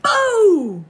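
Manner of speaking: sudden high loud BOO; shouted boo. Acted. Preston's dad startling his son with a loud boo. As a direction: scare him right back. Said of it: "says Preston's dad".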